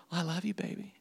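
very soft, mock-soothing husband; tender sweet-talk. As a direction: coo it quietly to an upset wife. Voices husband calming his wife.

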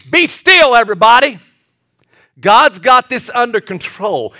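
loud, high rallying cry; commanding shout. Acted. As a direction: call out over a frightened crowd. Commands crowd at the Red Sea.

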